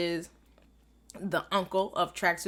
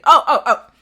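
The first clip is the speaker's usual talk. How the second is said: much louder, higher bursts; sudden remembering; 6 semitones higher, 17 dB louder than usual. Real, not acted. Fan suddenly recalling the episode ending, excited. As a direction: blurt it as it hits you.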